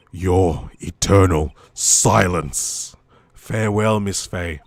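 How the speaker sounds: deep, loud, slow villain voice; menacing low bad guy farewell. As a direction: pronounce the death threat as the villain. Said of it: "I wanna do a bad guy voice".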